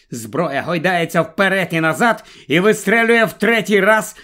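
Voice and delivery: ordinary delivery, nothing marked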